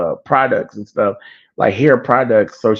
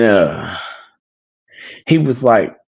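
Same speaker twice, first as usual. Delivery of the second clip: ordinary talk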